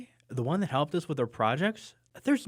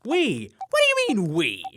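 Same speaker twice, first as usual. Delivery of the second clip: much higher, louder outraged "what"; indignant disbelief; 4 semitones higher, 7 dB louder than usual. Acted. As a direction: squeak it out in disbelief. Wally objects to being included.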